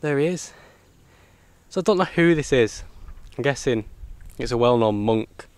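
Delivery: usual speaking voice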